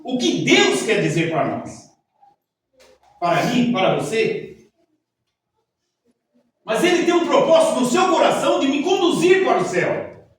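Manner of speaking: loud, fervent sermon delivery; preaching. Acted. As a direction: preach it with fire. Preaching at full force.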